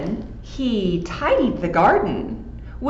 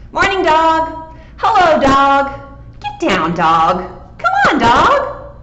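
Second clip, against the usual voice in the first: a few lightly voiced family members calling; family voices; 6 semitones higher, 5 dB louder than usual. Owners greeting their dog, lightly voiced and cheerful. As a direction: call the dog cheerfully, high and loud.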